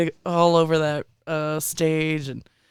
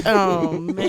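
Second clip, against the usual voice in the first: usual speaking voice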